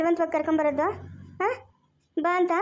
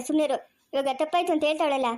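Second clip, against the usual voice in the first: ordinary delivery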